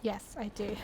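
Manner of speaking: ordinary talk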